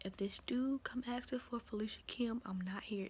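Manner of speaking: urgent hushed whisper. Retold or imitated. Whispering a warning to coworker Miss Liz. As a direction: keep it secret, all breath. Hiding from the man, warns coworker.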